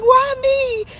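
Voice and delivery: very high, drawn mock sob; imitated crying wail. Reader re-enacting her own overwhelmed moment, high tearful wail. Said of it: "I was crying and snotting".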